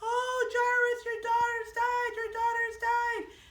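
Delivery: high, loud, drawn-out wail; keen it like grieving mourners. Acted. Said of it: "everybody was crying and crying".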